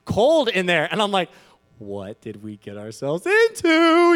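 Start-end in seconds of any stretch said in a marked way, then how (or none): 1.8-4.1 slow, loud, dismayed inner cry; a sudden sinking fear in the dark; what have we done, slowly; dread. Retold or imitated. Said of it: "I'm just thinking this in my head".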